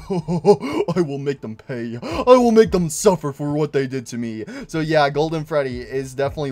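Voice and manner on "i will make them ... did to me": hammed-up evil; villain vow. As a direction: promise to make them pay. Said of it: "he's like".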